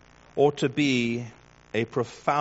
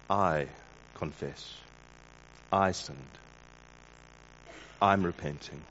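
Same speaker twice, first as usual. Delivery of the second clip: soft, slow confession; quiet, humble admission of sin; first person confession; 8 semitones lower, 8 dB quieter, slower than usual. Shown as a demonstration. Preacher quietly modelling a humble confession, soft and slow. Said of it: "In the first person singular".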